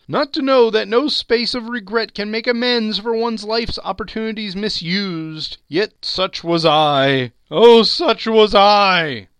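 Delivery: high, loud wail of regret, building to a cry; loud, high-pitched ghostly lament; anguished ghost. Acted. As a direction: wail like a tormented ghost, drawing out the last words.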